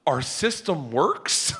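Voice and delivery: slow, rising incredulous question; drawn-out wondering question; amazed disbelief. Retold or imitated. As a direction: stretch it out in delighted disbelief. Amazed realisation, stretched out.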